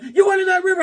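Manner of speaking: high mock-mother accusation; high scolding mom. Mother catching her son, high mock scold. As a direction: accuse him like a furious mom. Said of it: "she's like".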